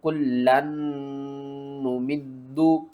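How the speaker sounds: कुल्लन नुमिद्दु with the noon held nasally; doubled lam and noon, slowly; nasal ghunna. Shown as a demonstration. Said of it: "गुन्ना करके पढ़ना है".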